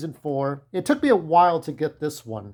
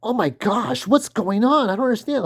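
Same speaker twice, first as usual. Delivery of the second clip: a lightly voiced whiny boy, pitched up; mock-whine it like a lost teenager; whiny young hero; 7 semitones higher than usual. Imitating a whiny young hero who cannot follow what is happening. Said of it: "he can be a whiny".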